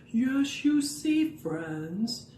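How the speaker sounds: lightly voiced wise professor owl; a hint of a lecturing owl. Owl puppet sets things straight for friends, lightly wise. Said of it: "Owl is very smart".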